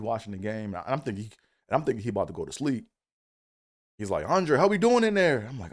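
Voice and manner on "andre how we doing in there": higher, louder mock call from the room; dad calling out. Dad calling to son from the TV room, louder.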